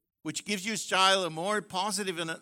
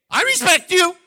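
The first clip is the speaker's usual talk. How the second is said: mock declaration; 13 semitones higher, 15 dB louder than usual. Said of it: "man's words to wife".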